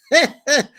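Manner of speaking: high, loud real laugh; burst of laughter. Real, not acted. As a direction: let a big laugh burst out. Host cracks up at scene.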